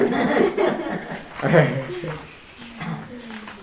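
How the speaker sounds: long high giggle; real high-pitched laughter; amused laugh. Real, not acted. Amused by the banter.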